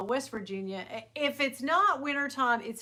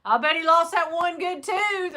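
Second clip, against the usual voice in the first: mock announcer; 7 semitones higher, 7 dB louder than usual. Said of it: "the guys talking were like".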